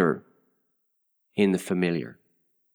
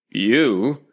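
scornful louder "You?"; contemptuous; 3 semitones higher, 5 dB louder than usual. A narrator's reading. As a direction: sneer the single word. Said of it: "said the caterpillar contemptuously".

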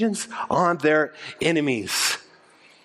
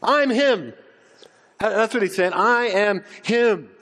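higher, confident "I'm him" boast; modern swagger; 6 semitones higher than usual. Retold or imitated. Preacher voicing Jesus in slang, higher and swaggering. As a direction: say it like a proud claim. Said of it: "in very modern vernacular".

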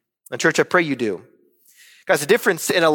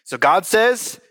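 usual speaking voice

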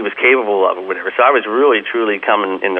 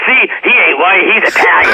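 higher and a bit louder, teasing; pointing him out to the other guy, mock-raised voice; imitating the guy; 9 semitones higher, 5 dB louder than usual. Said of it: "he goes".

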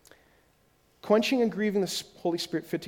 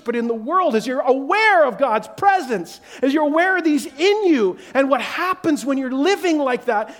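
full-force preaching; 7 semitones higher, 6 dB louder than usual. Acted. Preacher urging the church, loud and high. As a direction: lift it up and drive it home.

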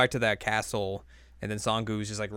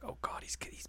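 whispering; 13 dB quieter than usual. Retold or imitated. Retold quote, unvoiced.

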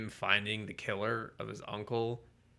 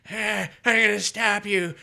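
higher, louder put-on old uncle voice; mock Uncle Ben; 11 semitones higher, 10 dB louder than usual. Mocking the uncle in the movie, a higher, louder old-man voice. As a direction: ham up the old uncle cutting someone off. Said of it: "he's just like".

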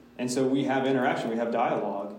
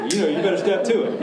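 raised, high, quick scolding; mock whipping; 7 semitones higher, 6 dB louder, faster than usual. Pastor voicing the good whipping they want, loud mock scolding. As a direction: scold them loudly as a joke, rushing it. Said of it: "a good whipping".

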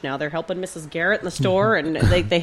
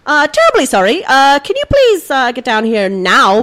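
mock polite; 5 semitones higher, 7 dB louder than usual. Calling cleaning staff back, exaggeratedly polite. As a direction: fake courtesy barely hiding urgency. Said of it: "be like".

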